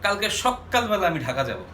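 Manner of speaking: সকালবেলা punched with a doubled K, "shok-kal-bela"; stress on সকালবেলা. Shown as a demonstration. Teacher giving an everyday example, সকালবেলা stressed with a long K. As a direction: lean hard on the K in সকালবেলা. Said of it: "শব্দকে আমরা খুব জোর দিয়ে উচ্চারণ করি".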